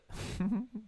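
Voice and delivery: high laugh. Real, not acted. Laughs mid-sentence.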